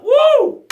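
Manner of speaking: short, high, loud "woo"; celebratory whoop. Real, not acted. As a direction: cheer the listener on with a whoop. Cheering a listener's gratitude.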